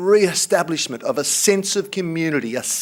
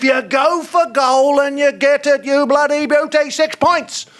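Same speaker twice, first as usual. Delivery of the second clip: mock cheer; 8 semitones higher than usual. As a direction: cheer the goal like a mock commentator. Mock commentator cheering a goal.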